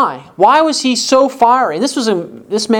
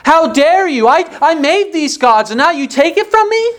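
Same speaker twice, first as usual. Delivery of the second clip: higher, indignant "how dare you"; acted outrage; 8 semitones higher than usual. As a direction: play Micah, affronted that his gods were taken. Voices Micah's outrage.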